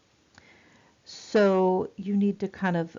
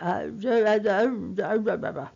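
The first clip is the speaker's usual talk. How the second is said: struggling, garbled attempts at words; mock stroke patient trying to get words out; imitated aphasic stammer; 2 semitones higher than usual. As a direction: inarticulate stuttered babble. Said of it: "They cannot speak. They, um, try".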